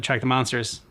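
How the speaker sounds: ordinary talk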